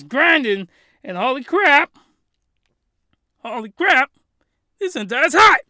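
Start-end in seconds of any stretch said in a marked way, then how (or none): 1.2-4.1 loud, high burst of excitement; excited. Real, not acted. Reviewer hyping the hentai to viewers, loud and excited. 4.8-5.6 much higher, louder burst; excited. Real, not acted. Hyping the hentai again, high and loud. Repeated hype catchphrase.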